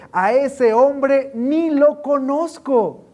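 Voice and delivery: slow, loud, high denial; emphatic denial. Acted. Peter denying Jesus, loud and slow. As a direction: deny him hard and slow.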